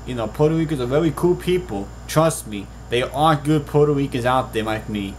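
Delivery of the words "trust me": ordinary talk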